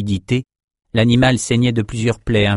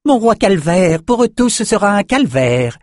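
high, yapping lapdog voice; high, yapping servile shaman; yapping like a dog; 11 semitones higher, 4 dB louder than usual. A narrator's reading. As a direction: yelp it out like a fawning pet. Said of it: "en aboyant".

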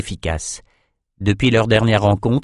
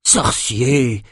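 startled; 2 semitones higher than usual. A narrator's reading. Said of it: "Le bourgmestre sursauta quand il reconnut Nathan".

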